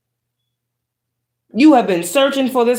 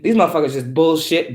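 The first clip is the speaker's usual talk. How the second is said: ordinary talk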